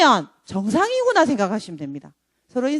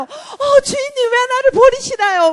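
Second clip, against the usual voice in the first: much higher, tearful cry of the abandoned; wailing mock scream; 11 semitones higher, 4 dB louder than usual. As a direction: plead in a high, hammed-up wail. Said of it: "막 이렇게 소리를 지르죠". (울부짖듯 과장해서 소리 지르기)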